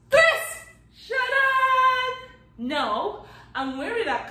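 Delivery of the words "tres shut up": high, loud sung "tres, shut up"; excited reveal. Acted. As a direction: sing out the magic reveal. Magic countdown reveal.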